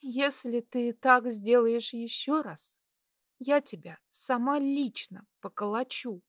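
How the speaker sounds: put-on high girl's voice, threatening through tears; tearful threat. A narrator's reading. Girl scolding the patient she nearly lost, tearful threat. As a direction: threaten him as if near crying. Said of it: "плача угрожала она".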